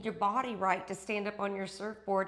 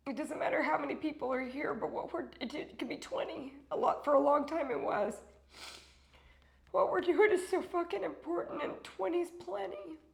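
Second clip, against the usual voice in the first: tearful; 5 semitones higher than usual. Real, not acted. Emotional thanks to viewers.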